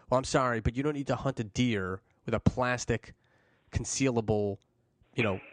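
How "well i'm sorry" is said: usual speaking voice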